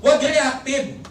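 ordinary delivery

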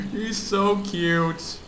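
giggle, then slow high coo. Real, not acted. Gamer cooing at a baby zombie, slow and sweet. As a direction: gush over something tiny.

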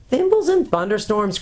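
enraged cry. A narrator's reading. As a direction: explode with an old dwarf's oath. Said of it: "cried Trumpkin in a rage".

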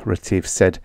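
usual speaking voice

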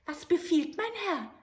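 high, eager little goblin voice; bow and ask for orders; little grey man. Acted. Grey manikin appearing to the soldier, eager.

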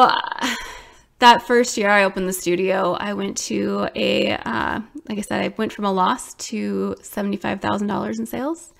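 ordinary talk, nothing marked

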